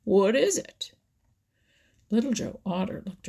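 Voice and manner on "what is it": louder, higher impatient demand; testy. A narrator's reading. Grandfather Frog pressing Little Joe for news, testy. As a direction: demand the answer a bit testily. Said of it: "replied Grandfather Frog testily".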